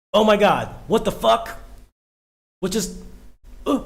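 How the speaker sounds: loud, high shocked outburst. Real, not acted. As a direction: blurt it out in disbelief. Reacting to a shocking scene.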